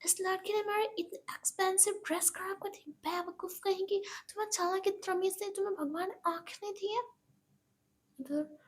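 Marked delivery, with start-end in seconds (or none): 0.0-7.0 high, fast scolding outburst; spoiled woman shrieking at a kid; shrill mock outrage. Rich woman screams at the girl who spilled juice, high and fast. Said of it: "Monika चिल्लाती है".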